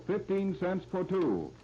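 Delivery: ordinary delivery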